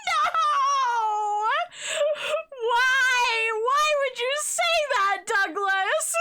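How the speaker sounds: high, loud horrified yelp; shocked outcry. Real, not acted. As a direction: cry out in disbelief.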